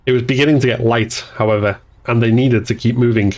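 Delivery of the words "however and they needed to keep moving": ordinary delivery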